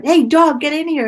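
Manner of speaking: loud, high call to the dog; pretend shout. Calling the dog named Dog in from the back door, loud shout. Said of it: "open the back door and shout".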